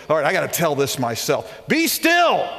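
loud, high commanding call to be still; God stepping up to the mic, booming; a booming divine command; God's commanding voice. Pretend. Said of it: "God stands up and moves this person out of the way".

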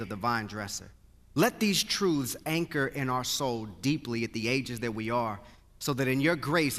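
low, earnest prayer voice sinking toward the amen; pastoral prayer. Acted. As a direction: pray over the congregation, unhurried. Pastor leads congregational prayer.

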